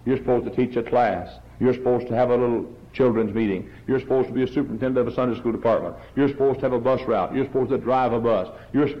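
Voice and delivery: normal talking voice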